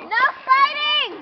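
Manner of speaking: high loud yell, stretched out; shouted order. Real, not acted. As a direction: shout it across the room. Yelling at others.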